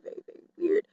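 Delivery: hushed whisper repeat. Shown as a demonstration. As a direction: whisper it under your breath.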